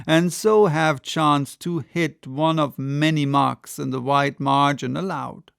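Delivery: ordinary delivery, nothing marked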